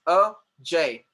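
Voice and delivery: "Ajay" slowly, A, pause, jay; two separate beats on "Ajay"; split into syllables. Shown as a demonstration. Teacher counting two syllables, "Ajay" split in two. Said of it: "two syllables".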